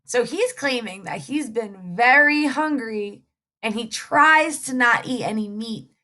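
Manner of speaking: plain reading voice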